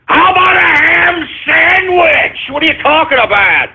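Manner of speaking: high, incredulous outburst; indignant shout. Real, not acted. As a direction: yell it high, drawing out the words.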